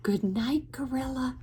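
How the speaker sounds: soft little mouse bidding good night; mouse's good night. Acted. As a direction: say it small and soft. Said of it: "Mouse says".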